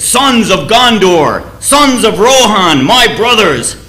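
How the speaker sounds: a king steeling his men before battle; a solemn war cry before the gates of the evil empire; rousing speech. Retold or imitated. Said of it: "gives this rousing speech to the men".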